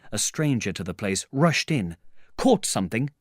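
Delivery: plain reading voice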